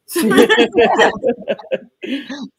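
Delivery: high, loud burst of laughter; bright laugh. Real, not acted. Guest laughing at her own cheeky line to her brother.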